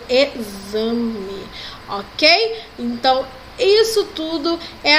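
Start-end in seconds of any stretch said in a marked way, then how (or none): 0.1-1.5 exame, x uitgesproken als z: e-za-me; careful demo word. Shown as a demonstration. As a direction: lage rustige demo van exame. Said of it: "o som do X de Z".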